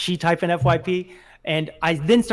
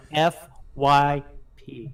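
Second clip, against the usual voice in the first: F, Y, P slowly, each letter set apart; slow spelling out; 3 semitones lower, much slower than usual. Shown as a demonstration. Explaining the page to co-host, F Y P said slowly letter by letter. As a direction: space out the letters F... Y... P.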